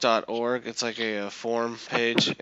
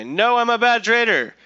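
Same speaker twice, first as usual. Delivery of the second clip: high, loud self-damning rant; loud dramatic forum-post outburst; yelled confession; 9 semitones higher, 7 dB louder than usual. Acted. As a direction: shout it out, theatrically exasperated. Ranting forum post read dramatically.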